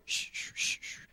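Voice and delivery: unvoiced shoo like a washing machine; preacher imitating his heart murmur, whispered whoosh; whooshing heartbeat. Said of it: "you've heard a washing machine".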